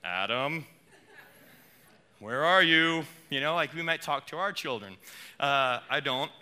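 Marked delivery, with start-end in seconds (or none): 0.0-3.0 angry bellow. Shown as a demonstration. Preacher imitating an angry God calling Adam, loud. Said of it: "we typically hear".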